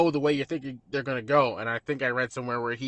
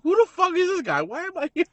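much higher, louder, baffled outburst; mock bewildered; 7 dB louder than usual. Waitress staring at the strange man, mock bewildered outburst. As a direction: play her stunned disbelief at this man. Voicing her baffled look.

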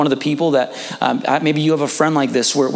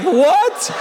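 high, rising incredulous yelp; mock astonishment, pitch shooting up; stunned disbelief; 13 semitones higher than usual. Team hearing about the haystack, stunned disbelief. Team's stunned reply, retold.

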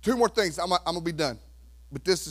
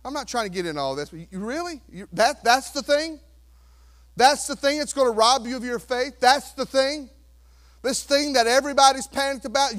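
usual speaking voice

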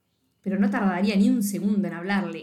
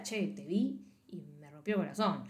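quiet hurt; 6 semitones lower, 11 dB quieter than usual. Pretend. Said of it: "pero le diría".

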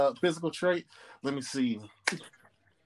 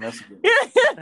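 mock high voice; 6 dB louder than usual. Friend repeats compliment in mock voice.

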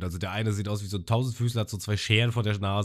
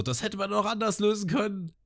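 mild mock yell; 11 semitones higher than usual. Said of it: "Und die schreien alle so".

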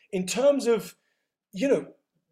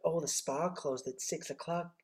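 softer, slow mock American receptionist accent; a quieter, drawn-out American accent like a receptionist; 2 semitones lower, 9 dB quieter, slower than usual. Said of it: "The reason why I'm doing an American accent".

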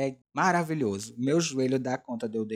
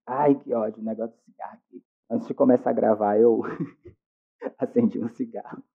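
raspy voice after a cigarette; rough smoky voice; smoker's rasp; 7 dB louder than usual. Real, not acted. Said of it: "minha voz de fumante".

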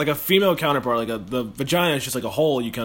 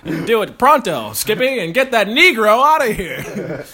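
put-on slow, loud old-timey gangster bark; 6 semitones higher, 5 dB louder, slower than usual. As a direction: ham up an old-time tough guy. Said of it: "the quintessential fucking old-timey voice".

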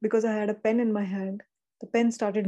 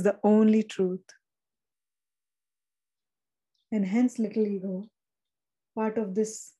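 channeled message reading. Acted. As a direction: speak as the divine comforting the little ego.

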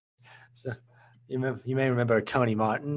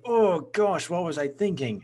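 mock dismay; 7 semitones higher than usual. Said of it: "if you do look back on them and think".

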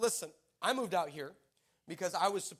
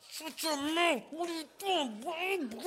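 hushed scolding; 9 semitones higher, slower than usual. Retold or imitated. Embarrassed parent hushing son.

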